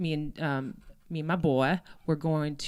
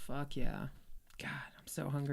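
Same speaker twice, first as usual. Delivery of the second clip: whisper; 2 semitones lower, 14 dB quieter than usual. Real, not acted.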